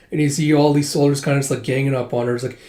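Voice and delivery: ordinary delivery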